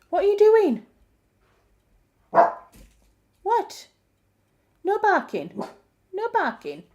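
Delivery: high-pitched, falling reprimands; pet scolding. Real, not acted. Owner to barking dog, high pet scolding.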